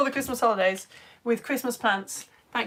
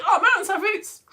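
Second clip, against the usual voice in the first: high, loud burst of excitement; thrilled exclamation, acting out the moment; excited; 9 semitones higher, 5 dB louder than usual. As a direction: re-enact an excited discovery, pitched up. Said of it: "I got really excited".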